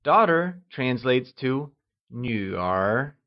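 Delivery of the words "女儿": "女儿" drawn out, dipping low then rising; falling-rising tone. Shown as a demonstration. Teacher models the word for daughter, slow "女儿" with falling-rising tone. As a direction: dip down and climb back up on "女儿", slowly. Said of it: "pronounced using the falling rising tone".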